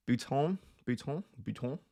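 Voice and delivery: accidental French-accented place name. Real, not acted. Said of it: "said that in a French accent".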